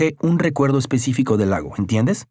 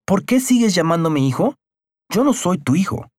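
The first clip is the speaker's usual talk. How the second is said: raised, indignant protest; outburst; 8 semitones higher than usual. A narrator's reading. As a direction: burst out at the old man. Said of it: "Carlos estalló". (estallido indignado, más agudo)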